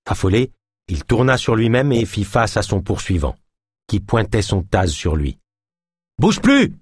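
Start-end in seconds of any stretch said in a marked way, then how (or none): 6.2-6.8 high, loud command to freeze; barked order. Acted. Ganesh orders the fleeing suspect to freeze, loud order. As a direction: order him to stop dead. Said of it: "ordonna Ganesh".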